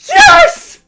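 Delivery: piercing, very high "yes"; triumphant scream. Real, not acted. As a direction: scream a victory. Celebrates a win.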